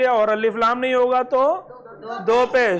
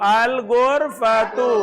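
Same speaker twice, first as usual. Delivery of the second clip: अल गुरफतु said slowly, each syllable drawn out; slow, drawn-out model reading; much slower than usual. Shown as a demonstration. Teacher repeats अल गुरफतु slowly for pupils. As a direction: stretch out अल गुरफतु so pupils hear the final u. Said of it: "क्या पढ़ेंगे?".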